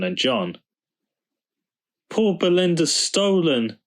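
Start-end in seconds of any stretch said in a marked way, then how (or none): 2.1-3.8 put-on high childlike wail; high wailing cry of loss. A narrator's reading. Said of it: "wailed the children".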